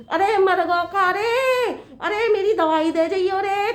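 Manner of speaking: high, long-drawn holler; wailing call. Acted. Mother-in-law acting out a call for medicine, wailing call. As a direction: stretch each phrase into a plaintive summons.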